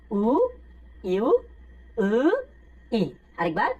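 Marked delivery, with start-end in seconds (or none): 2.0-2.1 উ said with the front teeth held together, lips spread. Shown as a demonstration. Teacher shows students Korean eu, উ with front teeth together. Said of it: "উটাকে সামনের দুই দাঁত চেপে বলবো".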